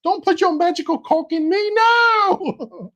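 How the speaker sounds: high falsetto, mock protest; girl's voice. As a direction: shriek it in a falsetto girl voice. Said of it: "all in a girl's voice".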